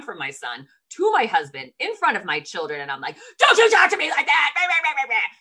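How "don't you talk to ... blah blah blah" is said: high, loud mock tirade; petulant scolding. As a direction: rant shrilly like a petulant child, hammed up. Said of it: "like a petulant child".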